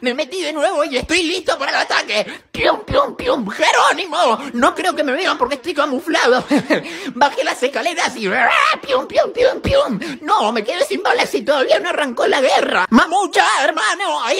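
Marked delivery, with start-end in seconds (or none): 2.5-3.5 high pium blasts; mock gunfire. Kid playing war, high mock gunfire. Gun noises. 8.8-10.0 high pium blasts; mock gunfire. Kid playing war, high mock gunfire. Gun noises.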